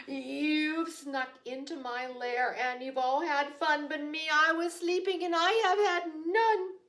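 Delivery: loud, high, indignant bear complaint; grumpy bear. Acted. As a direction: scold them like a cranky woken bear.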